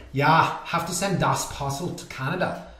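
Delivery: put-on German accent; playful mock German voice. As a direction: imitate a German shipper's accent. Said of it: "That's my German accent".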